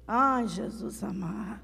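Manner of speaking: mock-suffering groan, soft and low; sick man whining. Wife mimicking a man with flu, feeble exaggerated moan. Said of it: "Agora com a gripe".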